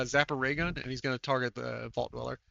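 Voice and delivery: normal talking voice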